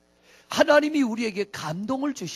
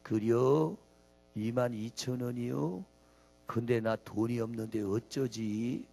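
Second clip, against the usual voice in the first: lower, slow Chungcheong customer; slow Chungcheong drawl; 9 semitones lower, 8 dB quieter, slower than usual. Retold or imitated. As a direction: drag the words like a Chungcheong local. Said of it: "이 밥 먹은 사람이 뭐냐?". (느릿느릿한 충청도 사투리)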